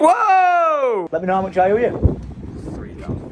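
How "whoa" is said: surprised whoa. Real, not acted. Shocked at invoice price.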